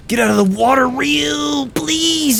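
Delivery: high, urgent cry; exasperated pleading. Real, not acted. Player urging Ryu out of the water, pleading. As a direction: plead desperately, pitch climbing. Pleads with game character in danger.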